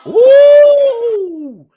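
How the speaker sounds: a loud mocking ooh at the clip; teasing ooh. Real, not acted. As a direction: react like a crowd hearing a burn. Reacting to the clip.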